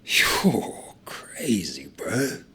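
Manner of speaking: amused whisper. Acted. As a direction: breathe it in disbelief. Whispered disbelief.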